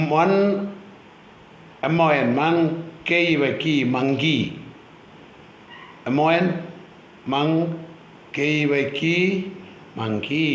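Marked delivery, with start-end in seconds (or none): 0.1-3.7 monkey spelled letter by letter, each syllable then sounded separately. Shown as a demonstration. Teacher models a word for pupils, monkey spelled out in two syllables. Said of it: "Say the following words". 6.1-9.2 letters then syllable, മങ് pause കീ; spelled out by syllable. Shown as a demonstration. Teacher repeats for pupils, monkey spelled in separate syllables. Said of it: "Say the following words".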